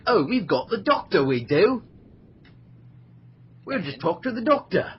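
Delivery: hammed-up Cockney Brit bragging about the Doctor; mock Cockney. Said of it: "He's vaguely trying for Cockney".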